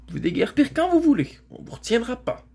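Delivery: high, cross retort; defiant dismissal. Acted. Shasta telling the girl to clear off, crossly defiant. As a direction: tell them off in a high voice.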